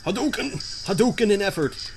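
high shouted Hadouken call; mock battle cry. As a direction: yell the move like a fighter. Shouting a fighter's move name.

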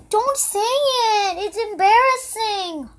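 high, loud, slow pleading doll; embarrassed doll voice. Pretend. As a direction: whine it like a mortified doll. Doll begs mom to stop.

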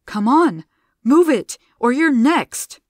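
loud, high urgent command; urgent yell. A narrator's reading. Gianna yelling at frozen Zilla, urgent. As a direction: shake her into moving, shouting.

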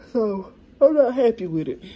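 telling viewers the table is too small; fed up. Real, not acted. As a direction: declare it loudly, done with this table. Unhappy about too-small table.

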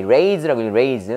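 "raise" said as rage, with an ay vowel; long A in rage. Shown as a demonstration. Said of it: "A니까 A".

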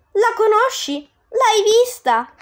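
high, slow amazed questions; eager surprise. Acted. Dante hearing of Beatrice, high eager wonder. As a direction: ask in breathless wonder.

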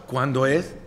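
usual speaking voice

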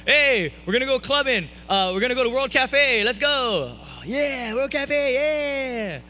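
high, loud mock party hollering; hyped-up friends calling. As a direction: whoop it up like friends heading out clubbing. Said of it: "all my friends were calling me up".

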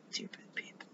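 under-the-breath whisper; a whispered jab at the pastor's wife; whispered aside. Real, not acted. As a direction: mutter it just above a breath. Whispered aside in angry rant.

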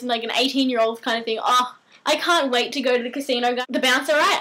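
normal talking voice

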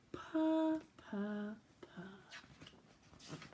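purring that fades to nothing; fading purr. Acted. Cat falls asleep.

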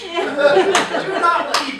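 mock warning while still laughing. Real, not acted. Laughing admonition.

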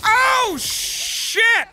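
a sudden high, loud yelp of shock; startled yell. Real, not acted. Gamer gets jumped in a fight, startled loud yelp. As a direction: caught off guard, yelp it out high.

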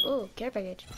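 ordinary talk

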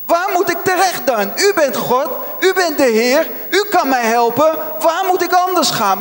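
high-pitched, insistent pleading; desperate protest. Acted. Believer asking God where else to go, desperate. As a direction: plead upward as if out of options.

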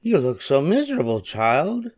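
high, kindly fairy godmother. A narrator's reading. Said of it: "said a kind voice".

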